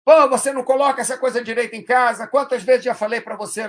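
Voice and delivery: exasperated nagging rant; mock angry scolding. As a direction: scold like a fed-up parent. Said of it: "reclamar de forma agressiva".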